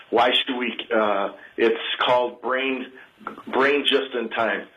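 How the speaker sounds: ordinary delivery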